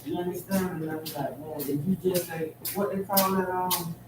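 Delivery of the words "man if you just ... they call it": ordinary talk, nothing marked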